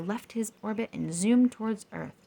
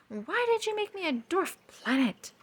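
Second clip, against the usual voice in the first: thundering Pluto; 12 semitones higher than usual. A narrator's reading. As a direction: demand it, thundering. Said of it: "Pluto thundered".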